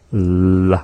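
La with air hissed past the side of the tongue; La voiceless at the start, breath at the sides; lateral fricative. Shown as a demonstration. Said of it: "That is the L H".

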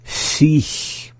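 SICH in one go, high, ending in a hiss; up to speed. Shown as a demonstration. Teacher saying the word for repeating, up to speed. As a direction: say SICH compactly, hissed ending. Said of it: "up to speed".

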